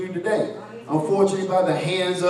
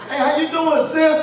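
hearty greeting; 9 semitones higher than usual. Retold or imitated. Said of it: "you're like".

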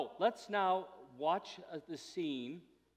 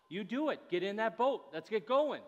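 a higher, louder urgent command; forceful order; 6 semitones higher, 4 dB louder than usual. Retold or imitated. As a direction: push them into the boat. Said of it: "different flavor".

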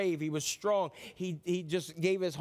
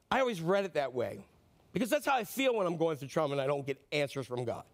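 ordinary talk

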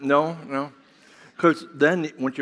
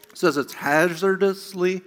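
talking with sour candy in mouth; eating sour candy; 5 semitones higher than usual. Real, not acted. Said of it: "can't even talk".

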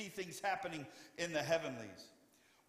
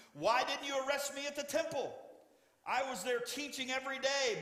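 much higher and louder; Jesus challenging the temple guards, higher and louder; indignant challenge; 10 semitones higher, 4 dB louder than usual. Acted. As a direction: confront the armed crowd. Jesus confronting the arresters.

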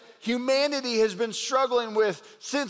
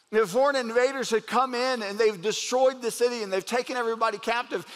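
ordinary talk, nothing marked

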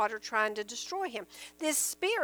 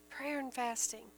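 much softer, near the usual pitch; hushed repeat; 9 dB quieter than usual. Real, not acted. Preacher repeating the answer to the congregation, hushed. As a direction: say "Prayer and fasting" softly, letting it settle.

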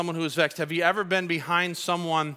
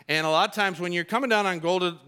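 normal talking voice

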